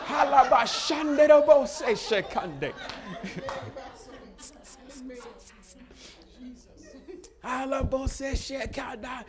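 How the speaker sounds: high, slow tongues, partly laughing; high, slow glossolalia with a laugh; speaking in tongues. Retold or imitated. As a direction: pray in tongues, high and drawn out. Praying in tongues, retold.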